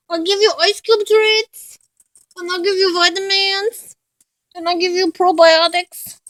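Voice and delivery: high, loud pet voice; sing-song promise of treats. Real, not acted. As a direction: coo brightly at the dog, pitched high.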